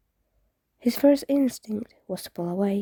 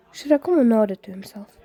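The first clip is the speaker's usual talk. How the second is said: usual speaking voice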